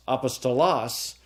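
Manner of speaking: apostolos with stress on final LOS; stress on last syllable. Shown as a demonstration. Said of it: "depending upon what, uh, syllable I accent".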